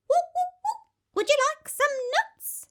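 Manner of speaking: high, bright monkey chirp; cheeky monkey voice. Acted. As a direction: play a chirpy little monkey.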